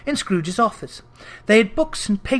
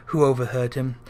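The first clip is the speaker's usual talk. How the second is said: usual speaking voice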